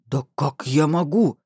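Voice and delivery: slow, mildly indignant man; a touch of slow bass outrage. A narrator's reading. Man protests he cannot intervene, mildly indignant drawn-out voice. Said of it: "возмутился бас и от сердитости загрохотал так низко".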